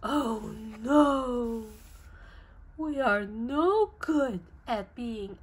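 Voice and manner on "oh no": usual speaking voice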